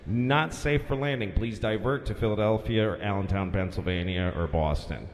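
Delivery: official radio bulletin sinking into a low drone; airport controller announcement. Acted. LaGuardia guy telling all planes to divert, droning official voice. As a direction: read the closure notice impassively, pitch dropping steadily. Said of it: "the LaGuardia guy comes".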